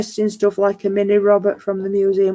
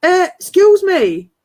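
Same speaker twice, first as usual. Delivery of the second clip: mock offence; 6 semitones higher, 6 dB louder than usual. Indignant that she's never wined.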